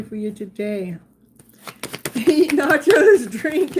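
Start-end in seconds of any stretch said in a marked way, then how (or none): 2.2-3.7 louder, slow, laughing reading of the card. Real, not acted. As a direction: burst out laughing as you read it.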